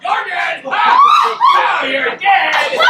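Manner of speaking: loud, high, drawn-out shout; mock threat. Husband pranking his wife in front of the guests, loud high shout. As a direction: yell it as a fake killer.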